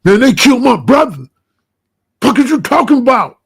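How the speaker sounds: loud, raw yell of a grieving man; angry shout. Retold or imitated. The shorty yelling at the speaker over his brother, furious shout. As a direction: shout it like your brother was just killed. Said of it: "He's like".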